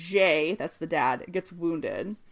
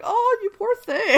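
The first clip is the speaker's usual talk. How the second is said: high-pitched, drawn-out pitying coo; 14 semitones higher, 4 dB louder than usual. Retold or imitated. Podcaster recalls feeling sorry for an insecure actor, pitying. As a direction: coo it with sympathy and a laugh in it. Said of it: "I felt so bad when I read that".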